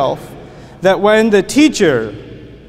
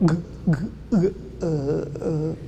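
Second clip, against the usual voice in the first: soft, slow frightened stammer; mock terrified stutter; 3 semitones lower, 10 dB quieter, slower than usual. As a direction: stammer like a scared kid.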